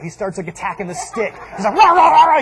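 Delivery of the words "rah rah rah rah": loud, high frantic barking imitation; freaked-out dog. Dog attacking mom's stick, imitated frantic barking. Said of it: "the dog freaks out".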